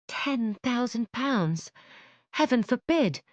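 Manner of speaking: high, slow appalled echo then cry; shocked disbelief. Acted. Elizabeth appalled at the ten thousand pounds, shocked. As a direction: recoil at the huge sum.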